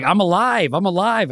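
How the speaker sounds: lightly acted cry of being alive; pleading victim. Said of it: "And he's like".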